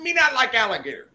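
high, fast broken-English imitation; complaining foreign buyer. Buyer rejects the alligator by phone, high mock complaint.

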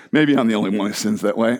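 normal talking voice

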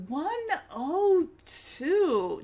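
slow, stretched-out readout; drawn-out reading. Retold or imitated. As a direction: draw out each number for emphasis. Reading out the thermometer for emphasis.

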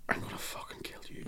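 murderous whispered threat; whispered murder threat to a kid; menacing whisper. Retold or imitated. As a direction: hiss it under your breath. Inner rage voiced as whisper.

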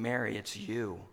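low, soft tender voice; hushed and loving. Acted. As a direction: speak it like a gentle revelation. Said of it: "he's saying".

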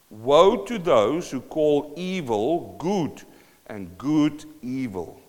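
slow, deliberate reading; read slowly. Shown as a demonstration. Preacher rereading the verse slowly for the congregation. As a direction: draw every word out. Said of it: "I'm gonna read it slowly again".

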